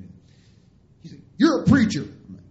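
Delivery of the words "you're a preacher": loud, high, angry outburst; fuming. Retold or imitated. Boss to preacher after the crash, fuming shout. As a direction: imitate an incensed boss blurting an accusation. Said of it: "he's fuming".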